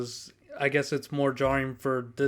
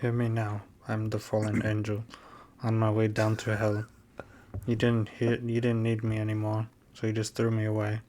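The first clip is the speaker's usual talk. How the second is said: goth; 4 semitones lower, 4 dB quieter than usual. Pretend. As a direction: read it like a dark goth poem. Reading gothic lyrics aloud.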